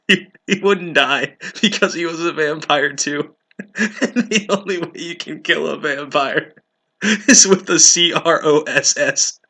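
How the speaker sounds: giggling through the words, high and loud; laughing. Real, not acted. Reader mocks the vampire logic, laughing it out. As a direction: fight off a fit of laughter. Cracks up mid-reading.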